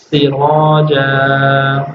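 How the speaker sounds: سراجا very slowly, with a long final ا; pausal form. Shown as a demonstration. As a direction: draw out سراجا and stop. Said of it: "ٹھہرنا ہو تو". (سراجا، آہستہ وقف)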